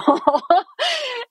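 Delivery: a sudden high laugh; high burst of laughter. Real, not acted. Doctor laughing at her patient's funny call, high laugh.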